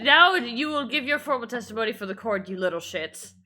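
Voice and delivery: stern judge. Acted. Judge scolding the witness.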